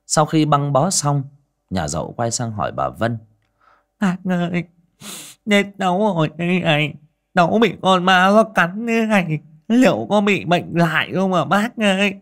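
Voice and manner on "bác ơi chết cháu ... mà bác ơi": high, whiny fearful woman; injured woman fearing rabies; worried Dậu. A narrator's reading. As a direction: fret to the aunt.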